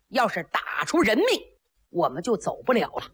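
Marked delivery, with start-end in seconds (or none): none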